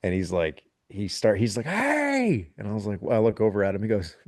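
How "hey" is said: high, drawn-out Southern holler; hammed-up "hey" pitched way up; imitated holler. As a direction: mimic a Louisiana man calling out. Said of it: "he's got a real thick accent".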